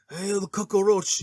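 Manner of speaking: mock the gangster's thick movie accent; drawn-out imitation Scarface accent; Al Pacino's Scarface accent. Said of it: "his accent".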